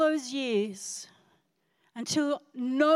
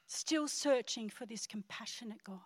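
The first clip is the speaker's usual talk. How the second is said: hushed whisper; 3 semitones lower, 7 dB quieter than usual. Retold or imitated. Remembering her desperate search.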